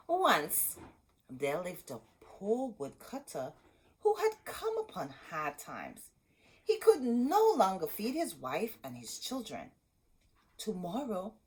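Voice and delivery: put-on lively read-aloud to kids; storybook reading. A narrator's reading. Teacher reading Hansel and Gretel aloud to children. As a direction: read the tale with gentle drama.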